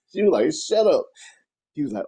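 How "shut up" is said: high, sharp imitated command; mock stern order. Woman silencing the man she whipped, mock stern. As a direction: imitate her shutting him up.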